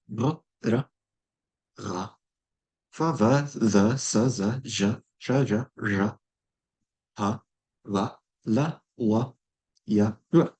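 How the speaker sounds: ma, na, pa, ba each clipped and separate; consonant demo. Shown as a demonstration. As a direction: sound out each consonant with a vowel. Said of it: "this is the combined phonemic inventory".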